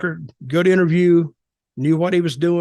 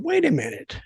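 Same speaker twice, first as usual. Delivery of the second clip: high-pitched, softly puzzled double take; retold disbelief; 8 semitones higher, 4 dB quieter than usual. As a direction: recall being taken aback, pitch jumping up. Retold reaction to puzzling accent.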